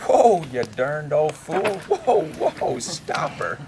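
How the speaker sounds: slow, loud "whoa" yelled in a panic; dreaming Pa's yell. Acted. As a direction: holler at a runaway mule. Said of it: "Pa yells right out loud".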